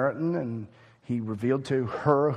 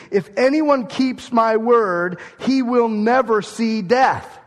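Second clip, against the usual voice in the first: slow, loud, higher, every word weighted; emphatic; 7 semitones higher, 6 dB louder, slower than usual. Shown as a demonstration. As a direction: proclaim it slowly and forcefully. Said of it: "Okay, this is emphatic".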